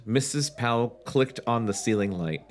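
ordinary talk

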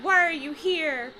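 high, drawn-out dramatic demand; hammed-up pleading cry; cried out. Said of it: "I cried".

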